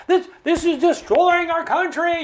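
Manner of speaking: hammed-up dramatic politician, laughing; hyperbolic outcry. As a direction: parody an overblown speech on the floor. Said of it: "these exaggerated statements".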